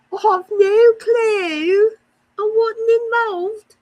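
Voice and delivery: high, drawn-out put-on voice; mock innocence. Streamer jokingly denying involvement, mock-innocent voice. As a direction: plead ignorance in an exaggerated high, slow voice. Jokingly disclaims the accent slip.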